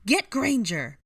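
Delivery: high, loud villainous command; fierce high-pitched yell; shouted order. A narrator's reading. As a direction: sic your allies on her. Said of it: "Bellatrix ordered".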